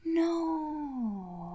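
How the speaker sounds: soft, high whimpering no; dismayed no. Real, not acted. Hearing the host was a creep, dismayed whimper. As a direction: let it out as a pained no. Shocked disbelief.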